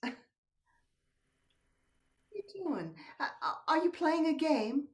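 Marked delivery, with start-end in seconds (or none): none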